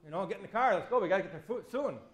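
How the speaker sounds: rally everyone to the car with big enthusiasm; eager go-ahead. Pretend. Pastor imagining answering God's call, eager and loud. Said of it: "I'd be like".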